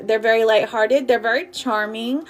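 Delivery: usual speaking voice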